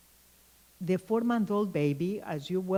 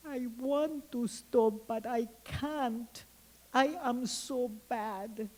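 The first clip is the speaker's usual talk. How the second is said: put-on higher, slow small-girl voice, softer at the end; little girl confessing; 6 semitones higher, 3 dB quieter, slower than usual. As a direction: play a preschooler admitting she can't stop. Girl confesses to angry mother.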